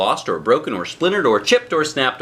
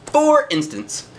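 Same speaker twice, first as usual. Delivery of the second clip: high, slow sing-song for instance; drawn-out singsong aside to the listening kids; playful refrain; 11 semitones higher, slower than usual. A narrator's reading. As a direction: stretch it out up high like a teasing refrain.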